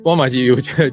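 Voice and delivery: ordinary talk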